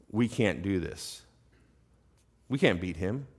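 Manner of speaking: low, soft resignation; defeated soldiers. Acted. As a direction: say it like you have given up. Voicing the frightened, defeated soldiers.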